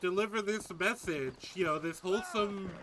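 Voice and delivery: put-on wholesome 1950s kid. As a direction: parody of a squeaky-clean farm boy.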